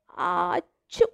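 loud muffled sneeze. Acted. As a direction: sneeze from inside the cupboard. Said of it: "sneezed the cupboard".

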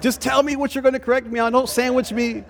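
quick, high-pitched mock protest; imitating exasperated wife. Wife telling husband to skip the sandwich, mock exasperated. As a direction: voice an impatient wife, pitched well up. Wife objects to softened correction.

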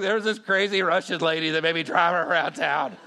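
loud, agitated retold outburst; frantic excuse. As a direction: blurt a mock-desperate excuse to the boss. Retold frantic excuse to his boss.

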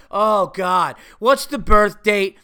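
loud, slow exasperated mimicry; mock her put-upon groaning question; impatient receptionist. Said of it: "in an impatient asshole way".

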